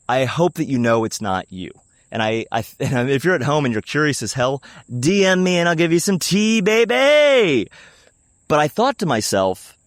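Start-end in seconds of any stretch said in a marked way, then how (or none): 5.0-7.7 loud, high, hammed-up gossip tease; playful holler. Host teasing curious listeners with gossip, playful holler. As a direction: crow it high and loud, stretching the last word.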